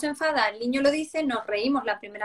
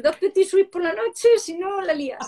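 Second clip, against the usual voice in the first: high, louder pleading bribe; bribing child; 8 semitones higher, 5 dB louder than usual. Retold or imitated. Mother bribing her child to leave the park, desperate. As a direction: offer the treat in a desperate sing-song. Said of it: "Y yo le tengo que decir".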